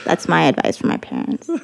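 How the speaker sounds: ordinary talk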